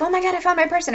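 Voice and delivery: high-pitched thrilled outburst; emotional excitement. Acted. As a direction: act out giddy joy at finding someone. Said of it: "really emotional and so excited".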